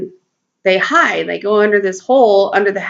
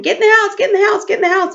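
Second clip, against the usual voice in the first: high-pitched mock yell calling the cats in; retold yell; 11 semitones higher, much faster than usual. Owner calling cats back into the house, high yelling. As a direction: call them in at a yell, pitched way up. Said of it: "I'm yelling and screaming".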